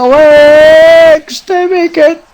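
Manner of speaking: high, drawn-out yell as the goal goes in; excited goal shout. Real, not acted. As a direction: stretch the words into a rising celebration roar. Goal scored during game commentary.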